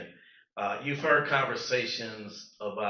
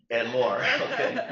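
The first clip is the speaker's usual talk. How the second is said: laughing; 2 semitones higher, 5 dB louder than usual. Real, not acted. Speaker laughs at own joke.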